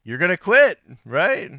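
loud, high-pitched exclamation; acting out listeners' reaction. Guest voices what listeners are thinking, loud exclaimed guess. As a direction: burst it out as the audience's imagined verdict. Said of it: "you're probably thinking".

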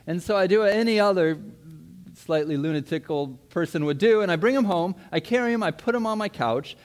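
normal talking voice

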